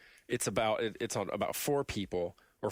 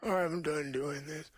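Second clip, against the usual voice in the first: higher-pitched mock old-man line; lightly voiced old guy; old guy imitation; 6 semitones higher than usual. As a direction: quote the old man in a put-on voice. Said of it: "the old guy yells".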